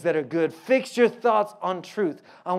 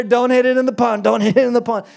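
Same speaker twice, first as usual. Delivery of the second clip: higher and louder, half laughing at himself; mock panicked self-talk; 4 semitones higher, 7 dB louder than usual. Preacher retelling his pond shot, louder mock panic. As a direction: replay the jitters big and comic. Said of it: "all the while I was standing there".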